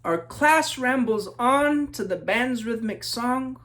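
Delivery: lilting rhyming read-aloud; story narration. A narrator's reading. Author reading to children, storybook narration. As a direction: read it like a picture book to kids.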